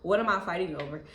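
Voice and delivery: ordinary talk, nothing marked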